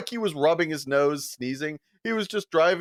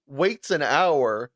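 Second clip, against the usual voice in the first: ordinary talk, nothing marked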